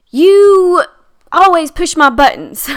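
high, loud accusing snap; mock accusation. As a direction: fling the blame at them. Example of an accusatory you-statement.

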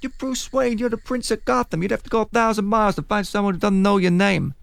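lightly put-on Italian-American mobster accent; hint of a Gotham mob boss accent; Falcone's accent. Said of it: "in his unbelievable accent".

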